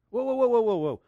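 high, loud run of whoas falling away; rapid-fire playful "whoa, whoa"; excited whoas. Real, not acted. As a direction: rattle off whoas, starting high. Exuberant whooping before happiness break.

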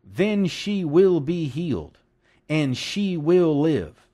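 slow, weighty stress on "will be healed" and "will live"; emphatic certainty. Acted. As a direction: declare it word by word, leaving no doubt. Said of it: "Now listen to this statement".

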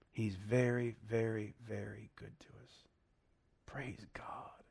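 whispered, breathy praise to God; reverent whisper. Real, not acted. Preacher whispers thanks to God after the reading, awed whisper. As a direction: hush it down to a whisper of awe.

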